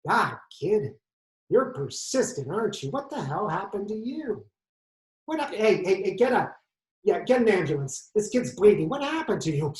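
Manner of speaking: exclaiming, pitch swooping up in alarm; Detective Mulroney. Acted. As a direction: play a startled veteran detective. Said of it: "that old mean guy, Detective Mulroney".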